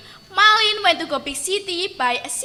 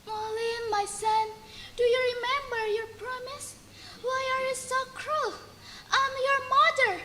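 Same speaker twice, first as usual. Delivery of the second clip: high, soft hurt pleading; sad plea; 6 semitones higher, 11 dB quieter than usual. Acted. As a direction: ask gently why he's so cruel. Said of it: "Malin's mother began sadly".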